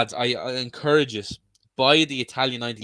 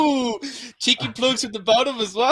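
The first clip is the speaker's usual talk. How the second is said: laughing; 12 semitones higher than usual. Real, not acted. Laughing at the plug.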